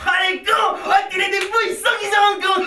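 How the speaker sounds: high, loud, elated outburst; excited shouting. Acted. Partner cheering the plan to fleece rich men, excited shouting. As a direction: shout as the trick dawns on you.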